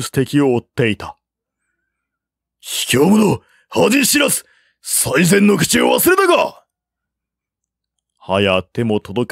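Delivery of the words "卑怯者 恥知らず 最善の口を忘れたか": furious insults. A narrator's reading. General cursing the fleeing officer, furious shouting. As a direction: hurl the insults after him. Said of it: "長江は罵りやまず".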